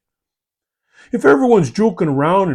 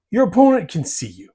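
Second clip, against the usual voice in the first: usual speaking voice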